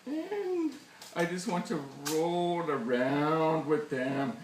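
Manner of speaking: melodramatic wail. Pretend. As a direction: draw it out, overcome with longing. Tearful climax of beer devotion.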